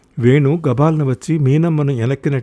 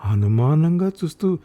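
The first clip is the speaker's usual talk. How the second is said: ordinary delivery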